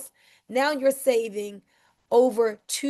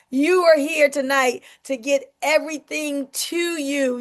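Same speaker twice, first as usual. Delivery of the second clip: ordinary delivery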